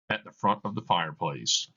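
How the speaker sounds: ordinary delivery, nothing marked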